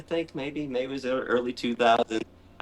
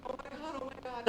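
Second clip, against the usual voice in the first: high, very soft gasped oh my God; hushed terrified whisper; breathless panic; 11 semitones higher, 18 dB quieter, faster than usual. Retold or imitated. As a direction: gasp it out in fright. Panicking over spider.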